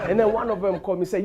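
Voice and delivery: usual speaking voice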